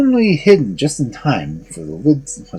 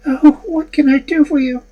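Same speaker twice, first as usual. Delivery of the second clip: high, anxious maiden; 4 dB louder than usual. Acted. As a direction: lift to a high young woman's voice. Maiden speaks.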